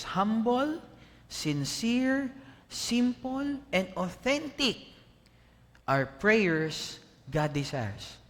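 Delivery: each word set apart, high; emphatic quoted list; listed quote. Shown as a demonstration. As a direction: tick off each quality slowly. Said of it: "I'd like to quote this".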